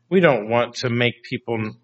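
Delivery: ordinary talk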